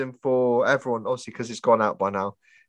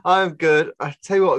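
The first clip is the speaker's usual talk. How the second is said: ordinary talk, nothing marked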